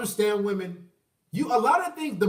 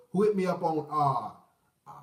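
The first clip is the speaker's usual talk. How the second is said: usual speaking voice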